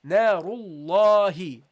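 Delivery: Narullahi slow, long drawn la; extended recitation. Shown as a demonstration. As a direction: stretch the alif in Narullahi. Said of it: "You see, I extend it".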